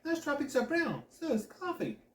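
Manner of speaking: high, matter-of-fact tease; teasing fake logic; mock innocence. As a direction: play dumb with a straight face. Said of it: "mock shock".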